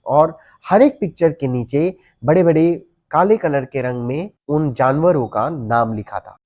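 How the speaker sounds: ordinary delivery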